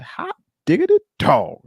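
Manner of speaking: very high, loud catchphrase whoop; playful celebration. Pretend. Host thanking a viewer for a super chat, playful celebration. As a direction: sing out a silly high-pitched cheer.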